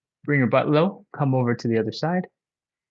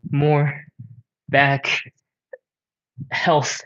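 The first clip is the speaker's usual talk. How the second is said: out of breath; much slower than usual. Real, not acted. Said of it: "Oh man, I'm out of breath".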